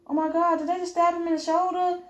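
horrified at what they just saw; shocked gasp. Acted. A bystander who just saw someone stabbed, shocked.

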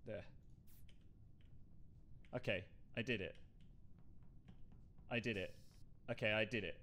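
low, soft self-talk; muttering to oneself. Real, not acted. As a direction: think aloud under the breath, low and hushed. Mutters to herself alone while playing.

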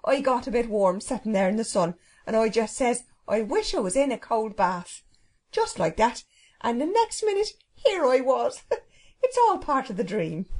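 cheery, folksy servant with dialect vowels; happy cook. Acted. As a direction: chatter blithely, high at the exclamations. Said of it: "replied the cook happily".

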